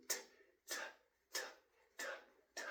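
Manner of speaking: repeated voiceless t sounds; whispered t drill. Shown as a demonstration. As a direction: tap out t, t, t with no voice. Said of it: "make the T sound".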